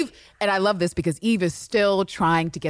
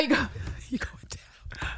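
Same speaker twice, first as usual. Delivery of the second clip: laughing whisper; 7 semitones higher, 8 dB quieter than usual. Retold or imitated. Quote dissolves into laughter.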